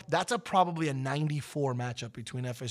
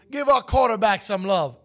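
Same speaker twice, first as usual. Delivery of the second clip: higher, louder rallying call; loud call to teammates; 9 semitones higher, 12 dB louder than usual. Retold or imitated. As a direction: rouse the team to cheer him. Warren calls to teammates.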